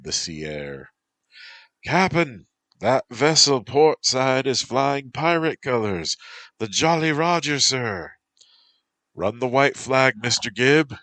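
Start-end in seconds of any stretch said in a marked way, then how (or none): 1.8-8.2 high, loud sailor's alarm call; urgent shout. Acted. Lookout calls to the captain about pirates, loud and urgent. 9.1-11.0 higher, loud order; loud command. Acted. Captain orders Gibb to surrender, loud command.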